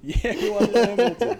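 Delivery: high, laughing through the words. Real, not acted. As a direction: laugh while you say it. Laughing recalling camper night.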